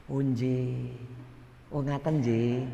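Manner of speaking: soft, humble, deferential nodding along; softly agreeing in humble Javanese; meek Javanese politeness. Retold or imitated. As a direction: play the polite yes-man. Humble polite reply quoted.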